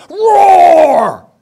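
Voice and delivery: loud high little-lion roar; child's roar. Retold or imitated. Grandson jumps out at grandpa, loud high roar. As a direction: shout the roar like a gleeful toddler.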